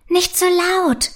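high, drawn-out alarmed plea; startled. A narrator's reading. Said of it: "sagt Prinzessin Arabella erschrocken".

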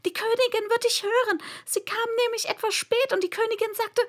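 high, rushed, panicky rabbit; frightened. A narrator's reading. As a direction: gabble it in high-pitched alarm. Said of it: "in sehr erschrecktem Tone".

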